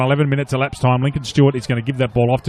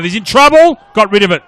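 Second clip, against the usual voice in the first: much higher, louder sudden alarm; excited call; 7 semitones higher, 8 dB louder than usual. Real, not acted. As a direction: shout the danger in the play. Live call, tense moment.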